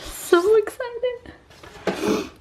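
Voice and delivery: very slow, high squealed so excited; drawn-out squeal. Real, not acted. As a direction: stretch it into a long squeal.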